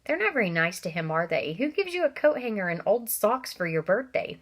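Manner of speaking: usual speaking voice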